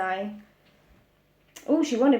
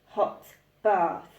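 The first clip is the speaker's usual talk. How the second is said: ordinary delivery, nothing marked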